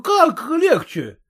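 high, slow outraged cry; drawn-out indignant question; indignant exclamation. A narrator's reading. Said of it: "восклицает ильковый соврас".

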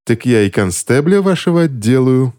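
ordinary delivery, nothing marked

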